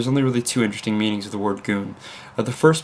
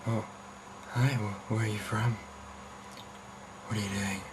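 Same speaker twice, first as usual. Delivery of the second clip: flirty caller voice; 13 dB quieter than usual. Retold or imitated. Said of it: "people start to flirt".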